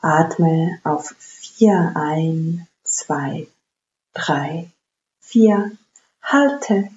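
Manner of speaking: slow, paced breath counting. Acted. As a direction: count each breath calmly and evenly. Counts the box breathing aloud.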